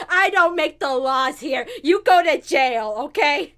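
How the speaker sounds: loud, put-on officious kid voice; little kid cop. Goten in police hat jailing Trunks, officious voice. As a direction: lay down the law loudly. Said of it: "Goten with, like, a little police hat".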